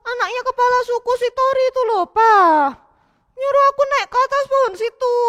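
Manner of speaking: put-on high, aggrieved child voice; upset boy telling tales. Matthew complaining to Papa about Tori, upset. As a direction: complain to your dad about the bully.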